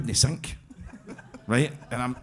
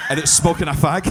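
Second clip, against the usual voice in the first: laughing; 3 semitones higher, 6 dB louder than usual. Real, not acted. Laughing at own punchline.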